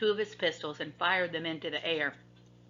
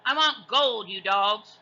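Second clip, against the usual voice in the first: higher, louder pirate demand; pirate captain; 4 semitones higher, 7 dB louder, slower than usual. A narrator's reading. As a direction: demand gold from the crew. Pirate captain scolds crew.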